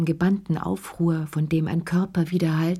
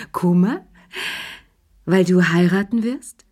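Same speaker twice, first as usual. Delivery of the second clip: louder, amused disbelief rising into questions; laughing; 4 dB louder than usual. A narrator's reading. Woman laughing at her lover's worry, amused and incredulous. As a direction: ask it loudly through mild acted laughter. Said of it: "herzhaft zu lachen".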